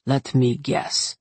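low, slow, dry deadpan. A narrator's reading. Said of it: "his voice dry".

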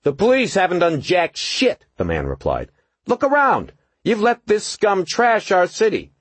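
high, loud contemptuous rant; angry young thug. A narrator's reading. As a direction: rant at the cop. Youth berates police.

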